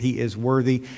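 ordinary talk

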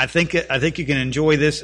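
ordinary talk

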